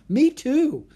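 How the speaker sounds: high, loud, excited chime-in; eager agreement. Acted. Annie agreeing she loves all creatures, excited. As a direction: jump in with delight.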